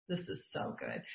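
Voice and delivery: breathy awed whisper, voice dropped away. Real, not acted. Coach admiring the team's checklist, whispered. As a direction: hush it like a secret treasure.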